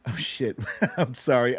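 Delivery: ordinary talk, nothing marked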